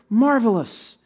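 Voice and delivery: loud, high, hearty congratulations; hammed-up enthusiastic well-wishing; gushing praise. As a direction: gush like guests in a receiving line. Guests' gushing receiving-line replies acted.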